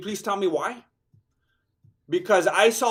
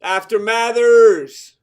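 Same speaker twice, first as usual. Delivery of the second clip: corner shout; 2 semitones higher, 9 dB louder, much slower than usual. Pretend. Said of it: "calling out to fighter".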